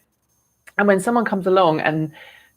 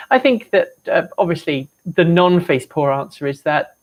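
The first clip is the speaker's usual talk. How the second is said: ordinary talk, nothing marked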